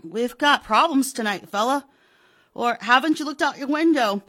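high, loud, insistent shouting; heated phone argument. Acted. Pratt arguing with the police sergeant, angry. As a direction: shout him down.

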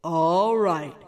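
low growling troll. A narrator's reading. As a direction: grumble it like a troll. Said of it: "said the troll".

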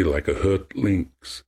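usual speaking voice

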